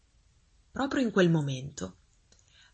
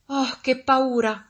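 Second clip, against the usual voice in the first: high, loud, drawn-out exclamation; storybook child's fright, played big; frightened little girl; 7 semitones higher, 6 dB louder, slower than usual. A narrator's reading. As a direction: gasp out the scare slowly, high-pitched. Rescued girl exclaims her fright.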